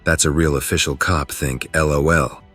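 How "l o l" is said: plain reading voice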